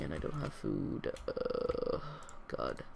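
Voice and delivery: usual speaking voice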